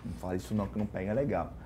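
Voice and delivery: low, soft aside; retold quiet advice. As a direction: imitate a discreet word in someone's ear. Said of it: "ele falava".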